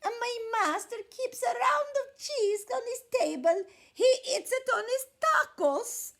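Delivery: high, loud tearful lamb; pleading little lamb. A narrator's reading. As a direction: beg the coyote in a tiny voice. Lamb's voice continues.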